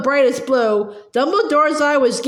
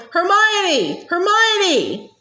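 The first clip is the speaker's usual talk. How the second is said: higher, calling out a name; bellowing; 5 semitones higher, much slower than usual. A narrator's reading. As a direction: bellow her name in anguish. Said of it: "Ron was bellowing".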